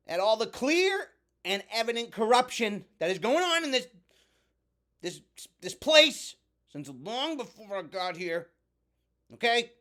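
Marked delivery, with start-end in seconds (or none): none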